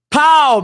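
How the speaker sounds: a loud, high burst for the taser hit; taser pow. Retold or imitated. The cop recalls firing his taser, with a loud sharp pow. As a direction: punch out a sharp pow like the shot. Taser shot sound in story.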